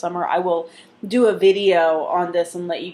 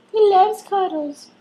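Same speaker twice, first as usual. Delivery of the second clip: much higher, slower sing-song pet voice; 12 semitones higher, 3 dB louder than usual. Real, not acted. Repeated in high pet voice.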